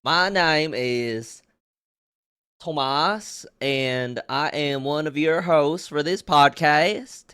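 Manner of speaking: loud, drawn-out Southern podcast intro; Southern accent. Pretend. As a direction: put on a slow Southern drawl to welcome listeners. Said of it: "you were doing a Southern accent".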